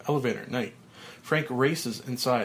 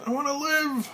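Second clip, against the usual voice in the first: rising desperate scream. Acted. Frank as dirt falls, plea climbing to a high scream. Repeated, even higher.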